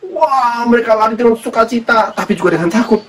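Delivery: high excited gasp of wonder, then racing on; excited storytelling. Acted. Preacher excitedly acts the women running.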